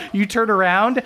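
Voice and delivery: ordinary delivery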